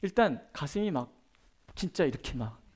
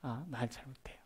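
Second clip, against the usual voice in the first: much softer, shy admission; timid mumble; 4 semitones lower, 12 dB quieter than usual. Shown as a demonstration. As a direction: mumble it like a nervous beginner. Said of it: "이렇게 얘기를 하는 거예요". (작게 웅얼거리듯 수줍게)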